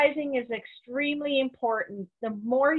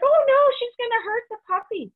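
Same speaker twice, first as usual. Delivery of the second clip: much higher and louder, mock alarmed; imitating a worried owner; 12 semitones higher, 6 dB louder than usual. Owner watching the mother dog discipline her pup, mock alarm. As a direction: squeal it like a panicked puppy owner. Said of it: "and you're like".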